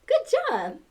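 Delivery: usual speaking voice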